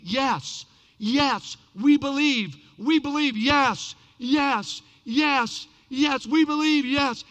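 loud, punchy crowd chant; insistent cheering in separate bursts; mock crowd screaming. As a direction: shout it out like a roaring crowd. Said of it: "they all started screaming".

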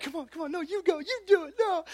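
put-on squeaky kids daring each other in hushed urgency; squabbling kids. Kids pushing each other toward the doorbell, high urgent voices.

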